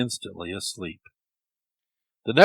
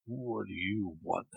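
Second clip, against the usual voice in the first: sleepy, much softer mumble; half-awake grumble; mumbled; 14 dB quieter than usual. A narrator's reading. Devil woken in the night, soft sleepy mumble. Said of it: "the devil mumbled".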